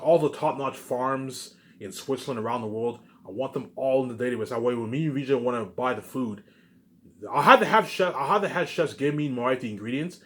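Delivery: usual speaking voice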